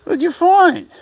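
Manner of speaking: high-pitched doggy-talk question; excited pet talk. Real, not acted. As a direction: squeal the question at your curious dog. Talking to the exploring dog.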